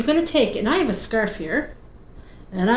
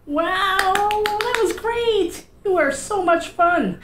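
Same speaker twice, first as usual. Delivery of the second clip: much higher, slow delighted praise; excited praise; 10 semitones higher, slower than usual. Acted. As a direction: gush over the little ones. Praising kids after the song.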